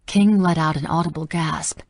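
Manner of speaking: ordinary delivery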